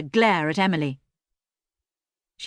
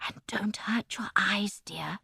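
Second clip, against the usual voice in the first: soft unvoiced whisper; 8 dB quieter, slower than usual. A narrator's reading. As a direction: whisper it gently to her. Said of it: "Granny Two whispered".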